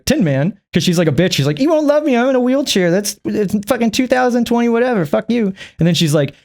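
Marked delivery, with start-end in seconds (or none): 1.6-5.5 mock whiny girl. Voicing the girl in the movie.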